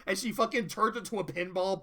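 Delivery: usual speaking voice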